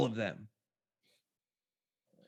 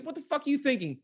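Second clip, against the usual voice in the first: incredulous, much higher; mock outrage; 12 semitones higher than usual. Fan scolding the park staff, incredulous. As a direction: hurl the question in disbelief.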